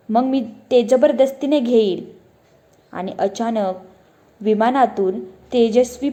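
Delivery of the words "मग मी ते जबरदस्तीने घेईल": usual speaking voice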